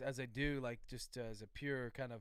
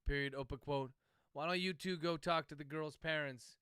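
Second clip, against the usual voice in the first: dictating; 4 dB louder than usual. Real, not acted. Author dictating novel chapter.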